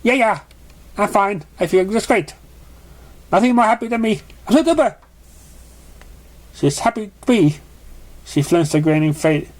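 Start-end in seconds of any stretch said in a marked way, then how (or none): 0.0-7.6 much higher, over-bright fake happiness; forced cheerful grin. A narrator's reading. Frightened pink pony faking happiness to the unicorn, forced cheer. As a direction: grin through the fear and chirp. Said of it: "put on a grin and try talking".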